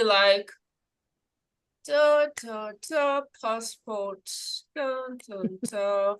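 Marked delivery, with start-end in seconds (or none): 1.9-6.2 unhurried sing-song collecting of things; soft, drawn-out syllables, playfully unbothered; mock nonchalance. Acts strolling calmly through house fire.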